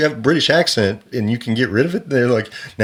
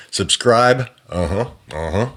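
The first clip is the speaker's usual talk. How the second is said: ordinary talk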